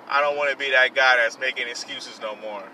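ordinary talk, nothing marked

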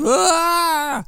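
sudden very high, loud imitated scream; mock scream. Patient on the spinning ride screaming, imitated high and loud. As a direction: let out a short shrill-high yell. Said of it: "you hear him yelling".

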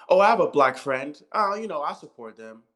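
sarcastic high, loud put-on voice of a smug ally; mocking fake ally. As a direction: sneer it like a self-satisfied liberal. Mocking hypocritical allies.

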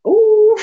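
high, loud whooping ooh into breathy laugh; big amused ooh and giggle; loud ooh then laugh. Real, not acted. Reacting to his own story.

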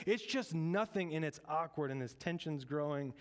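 ordinary delivery

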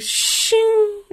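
high ringing blade sound; sword being drawn. Retold or imitated. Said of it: "He's like".

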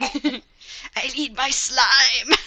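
slow, strained needy voice; drawn-out craving plea; frail breathless old person. Pretend. Mocking a sick infected person.

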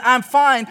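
"fine" falling, heavy with meaning; loaded "I'm fine". Shown as a demonstration. Husband decoding his wife's "fine", loaded meaning. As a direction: say "fine" like it means trouble. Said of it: "means you messed up".

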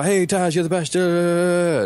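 high, fawning parody voice; mock gushing. Guest parodies himself sucking up to the host, high fawning voice.

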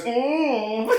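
high, camp hummed "mm"; Kenneth Williams. Retold or imitated. Vampire sasses fleeing Lestat, high camp hum. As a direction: let out a sassy, high-pitched moan. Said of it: "Like Kenneth Williams".

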